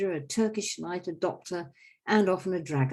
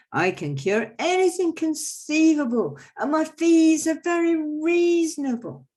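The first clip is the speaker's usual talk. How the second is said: very high, loud grand sales pitch; quack doctor boasting; 11 semitones higher, 8 dB louder than usual. Acted. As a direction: oversell it with a flourish. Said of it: "So I go".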